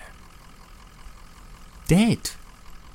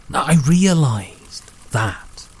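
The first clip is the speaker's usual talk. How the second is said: ordinary delivery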